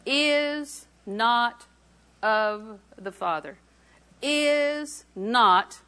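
weighty, spaced-out "is not of the Father"; emphatic repetition. Real, not acted. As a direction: stretch "is not", then drop slowly onto "of the Father". Repeats verse phrase for emphasis.

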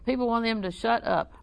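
usual speaking voice

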